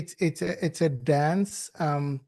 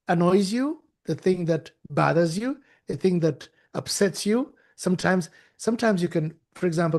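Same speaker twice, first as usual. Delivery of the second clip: ordinary talk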